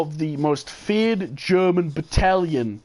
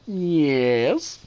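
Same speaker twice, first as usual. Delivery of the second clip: retold drawn-out answer. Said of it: "And go".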